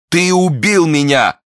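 high, loud, drawn-out accusation; stricken cry. A narrator's reading. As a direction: cry out as the wounded duke. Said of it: "вскричал Бекингем".

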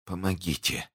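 very soft, failing voice; hushed dying breath; whispered plea. Acted. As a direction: barely get the plea out. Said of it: "шептала госпожа Бонасье".

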